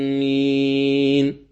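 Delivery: آمين with the vowel held for six full beats, very slow; six-beat Amin. Shown as a demonstration. Tajweed teacher demonstrating the long madd in آمين. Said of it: "هكذا وجها واحدا".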